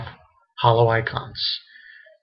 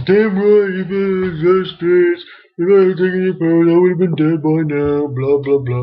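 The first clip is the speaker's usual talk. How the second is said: loud, high-pitched quote of a tough talker; mock aggressive rant; 4 semitones higher, 5 dB louder than usual. Reviewer acts out a manga character's cussing, mock aggressive rant. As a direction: belt it out high and loud, then fade into blah-blahs.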